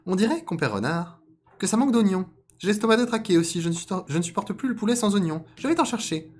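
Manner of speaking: high, loud cartoon voice; wily rabbit. Rabbit feigning need of onions, high voice. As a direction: rattle off the excuse in a squeaky pitch. Plays the rabbit making an excuse.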